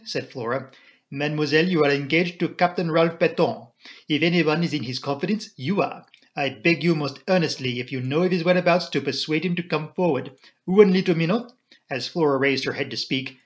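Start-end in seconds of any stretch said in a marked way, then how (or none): 1.1-11.5 put-on earnest accented detective; Poirot. Poirot begs Flora about her fiancé, earnest and accented. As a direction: plead most earnestly, with a foreign lilt.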